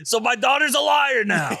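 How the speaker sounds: mock outrage. Real, not acted. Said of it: "mock outrage".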